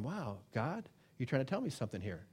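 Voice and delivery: hushed, quick aside to God; soft amazed murmur to God; quiet realization. Retold or imitated. Believer quietly realizing God speaks, hushed.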